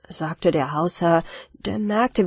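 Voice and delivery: usual speaking voice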